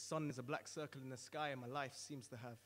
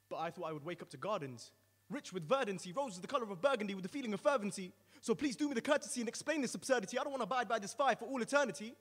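pleading, climbing higher and louder to a cry; desperate plea; 6 semitones higher, 8 dB louder than usual. Acted. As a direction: beg for mercy, rising to a cry. Sinner begs the angel.

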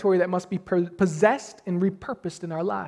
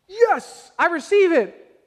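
eager acceptance; 8 semitones higher, 4 dB louder, slower than usual. Retold or imitated. Mock eager believer voiced.

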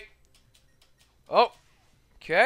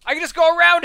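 high, loud, cracking voice; real voice crack, pitched high; 9 semitones higher, 7 dB louder, faster than usual. Real, not acted. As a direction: let your voice crack upward. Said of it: "My voice just cracked".

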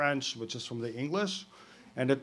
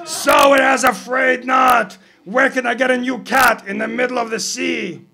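exasperated shout; 12 semitones higher, 14 dB louder than usual. Acted. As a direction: yell it up in frustration. Captain shouting back up.

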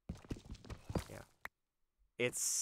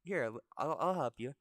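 lightly voiced old lady, starting higher; a hint of an obliging granny; 5 semitones higher, 4 dB louder than usual. Old lady agreeing to help the monkey, lightly acted. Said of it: "the old lady's like".

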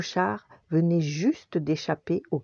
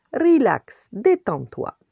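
louder, high-started storybook reassurance; playful calming; 8 semitones higher, 5 dB louder, slower than usual. A narrator's reading. Tony tells the jumping dog to relax, playfully loud. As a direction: tell an excited dog to settle.

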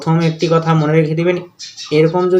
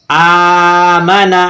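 slow, stretched start to আমানা; drawn-out opening vowel; much slower than usual. Shown as a demonstration. Teacher demonstrates the hamza, first vowel of আমানা held long. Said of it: "Hamza টেনে পড়ছি".

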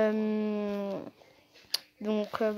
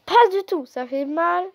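emphatic; 6 semitones higher, 5 dB louder than usual. Real, not acted. Reacting to the quoted wish.

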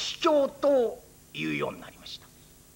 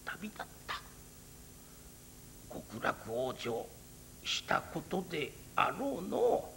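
put-on hushed, grieving old monk; sorrowful old priest; 8 semitones lower, 7 dB quieter than usual. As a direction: mourn the frozen pair in a near whisper.

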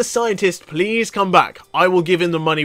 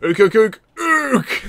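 playful ook-ook monkey noises, rising high at the end; imitated chimp chatter; monkey hoots; 3 semitones higher, slower than usual. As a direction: hoot like a monkey with one big last ook.

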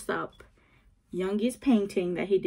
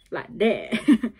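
"Like that" said louder, punchy; louder take; 7 dB louder than usual. Shown as a demonstration. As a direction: push the "Like that" out. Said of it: "He's like, he's like a".

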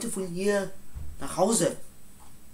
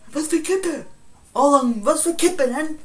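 much higher and louder, aggressive; angry retort; 7 semitones higher, 8 dB louder than usual. Acted. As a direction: snap back, picking a fight. Angry retort about the cigarette. (aggressiv und laut)

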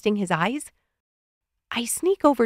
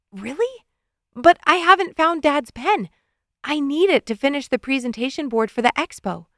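high, surprised objection; startled, pleading to mom; startled protest; 5 semitones higher than usual. Acted.

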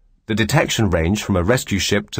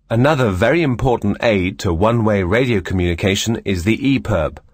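ordinary talk

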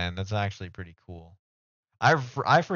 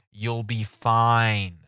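put-on reassurance; 6 dB louder, much slower than usual. Repeats line slow and loud.

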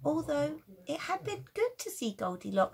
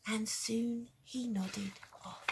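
ordinary delivery

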